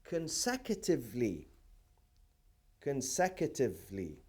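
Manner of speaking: CONSECUTIVELY drawn out syllable by syllable; slow dictation. Shown as a demonstration. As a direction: say "consecutively" slowly for note-takers. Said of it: "say it very slowly".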